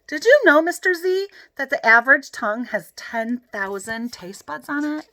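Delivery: butter up the dad with a fact, all eagerness; eager kid showing off. A narrator's reading. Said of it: "Frankie said".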